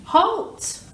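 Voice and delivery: high, loud rat bellow; deafening highwayman's halt. Acted. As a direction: bark it out to stop travellers. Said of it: "who bellowed a deafening".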